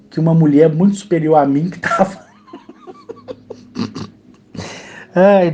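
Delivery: breaks into a real laugh mid-sentence; laughing through emotion. Real, not acted. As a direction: laugh it out, overwhelmed. Overcome, laughing while telling.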